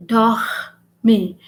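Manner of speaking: dormir split in two, with a rasped throaty R; dormir, dwelling on the R before "mir"; stressed French R. Shown as a demonstration. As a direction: lean hard on the R in dormir. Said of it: "when the French see R in a word".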